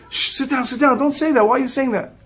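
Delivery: high, hushed pleading to stop; embarrassed, hushed protest; urgent hush. Retold or imitated. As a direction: shush him before anyone hears. Hushing the man who exposes him.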